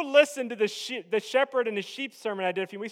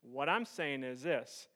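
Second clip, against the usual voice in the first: solemn hushed preaching; 9 semitones lower, 9 dB quieter than usual. Real, not acted. Preacher telling congregation the battle is the Lord's, solemn hush. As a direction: bring it right down, quiet and weighty.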